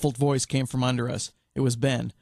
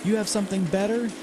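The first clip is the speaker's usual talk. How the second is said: yelling back; 8 semitones higher than usual. A narrator's reading. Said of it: "she yelled back".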